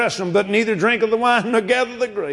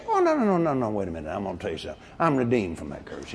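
calm firm correction; 14 semitones lower, 8 dB quieter, much faster than usual. Retold or imitated. Preacher answering someone scared of anthrax, calm low correction. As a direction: hush the worry, very low and sure.

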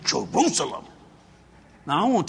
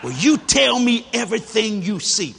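slow, loud insistent order, imitated; bossy wife; 5 semitones higher, 6 dB louder than usual. Wife shaking a finger at husband, bossy demand. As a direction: lay down the law like a bossy wife.

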